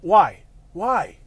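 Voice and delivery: exasperated furious yell; angry yell. Real, not acted. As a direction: bark the question at the driver in frustration. Raging at driver blocking traffic.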